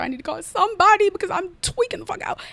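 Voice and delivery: high-pitched frantic rant, retold; going off. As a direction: rush it out in a strained, high mock freak-out. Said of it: "I'm just going off".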